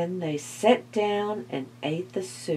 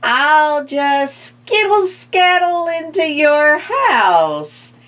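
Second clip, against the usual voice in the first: high, loud rattly skeleton voice; 9 semitones higher, 9 dB louder, slower than usual. A narrator's reading. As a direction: chatter it like a bony creature. Skeleton voice continues.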